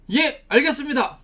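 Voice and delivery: eager, dutiful acknowledgement; obedient reply. Acted. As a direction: answer an order promptly and firmly. Acting obedient reply to God's order.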